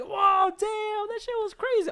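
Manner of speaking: mock amazement, like a wowed crowd; impressed crowd.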